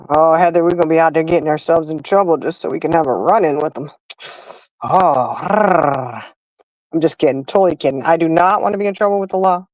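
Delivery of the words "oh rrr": a mock-flirty moan then a growl; a teasing "rrr" at the thought of cops; playful growl. Joking to Heather about a run-in, playful growl.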